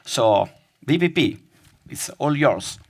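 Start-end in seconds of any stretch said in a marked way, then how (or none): none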